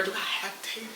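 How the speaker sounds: very soft, muttering to herself; muttered under the breath. Real, not acted.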